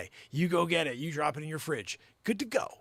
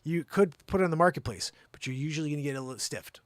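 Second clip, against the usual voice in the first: ordinary talk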